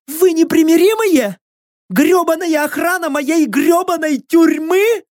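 very high, anguished cries; desperate screaming plea. A narrator's reading. Prisoner begging strangers to kill her, desperate screaming. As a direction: beg for death at a frantic high pitch.

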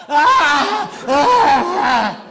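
shrieking high moans; loud mock moan. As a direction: wail it out like an over-the-top lover. Woman moaning in retold sex scene.

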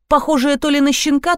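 ordinary delivery

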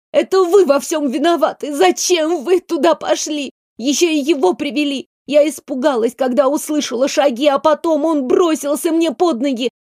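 high, tearful outburst; crying girl blaming the adults; tearful accusation. A narrator's reading. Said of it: "Девочка всхлипнула".

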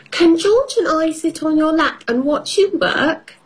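put-on high little girl voice; child voice. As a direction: squeak it like a small piglet. Peppa voiced in story.

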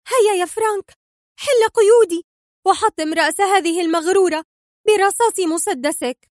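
high-pitched, gloating urgency; fervent excitement. A narrator's reading. Sonia urging Frank to shoot Mona, fervent excitement. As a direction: cry out commands with eager fury. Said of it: "فهتفت في انفعال".

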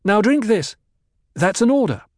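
commanding order. A narrator's reading. Jessica ordering drunk Idaho to drink, loud and commanding. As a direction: bark the order at him.

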